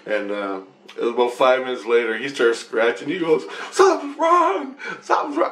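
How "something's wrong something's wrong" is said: much higher, half-laughing imitation of a panicked scream; retold panic, high and laughing; mock panicked scream. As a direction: ham up a frantic cry, pitched way up. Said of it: "I can hear him down there screaming".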